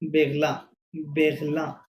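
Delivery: "বেগলা" with throaty R and final ah; French-style Berlin. Shown as a demonstration. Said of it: "উচ্চারণ কি হবে?". (ফরাসি ধাঁচে "বেগলা" উচ্চারণ)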